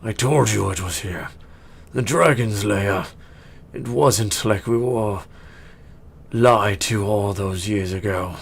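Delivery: vindicated orc. Acted. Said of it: "the orc is like".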